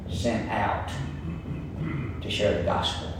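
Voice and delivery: low, soft, with long pauses; hushed preaching. Real, not acted. Preacher telling congregation God can use anybody, hushed. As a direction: drop right down and let each phrase land. Preacher drives point home in hush.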